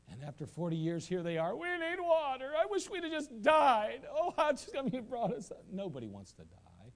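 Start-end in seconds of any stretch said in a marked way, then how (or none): 1.5-5.6 high, whining complaint; whiny Israelites. Retold or imitated. Preacher mimics the grumbling Israelites, high and whiny. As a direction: whine like thirsty people in the desert.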